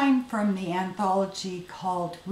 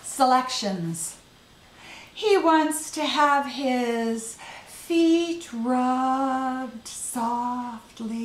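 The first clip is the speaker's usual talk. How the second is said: poetry reading; 5 semitones higher, 4 dB louder than usual. Acted. Poet reading own poem aloud.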